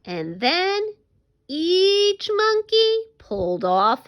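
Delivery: animated storytelling with big swings in pitch; story read-aloud. Acted. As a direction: read it to children, drawing out the key moments.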